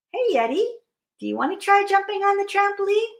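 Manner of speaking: high-pitched, quick cartoon voice; friendly invitation. Bear invites the Yeti to jump, high and quick.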